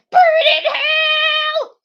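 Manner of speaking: very high, very loud, slow screamed "Burn in hell!"; metal scream. Retold or imitated. Vlogger straining his voice to copy a metal singer, screaming. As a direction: stretch out "Burn in hell!" as a sustained metal shriek. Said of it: "I had to work that voice".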